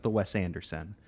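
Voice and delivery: normal talking voice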